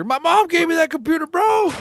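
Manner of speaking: high, whiny lament over the smashed computer; a hurt young guy whining; whiny Travis. Retold or imitated. Travis whines to C J about the computer, pitched high. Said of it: "smashing the computer".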